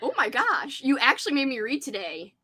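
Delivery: normal talking voice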